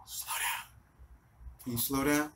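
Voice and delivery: barely audible whispered begging; feeble scared plea. Retold or imitated. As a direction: plead weakly, almost without voice. Scared passenger feebly begs driver.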